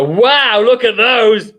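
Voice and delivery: a hammed-up crude leer; leering gawker. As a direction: act the creepy ogler who gawks out loud. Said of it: "you don't go".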